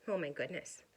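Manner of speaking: usual speaking voice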